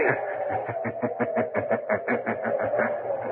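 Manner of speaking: distant, breathy ghostly laughter; a disembodied voice laughing from far off; eerie mocking laugh.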